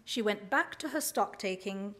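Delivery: usual speaking voice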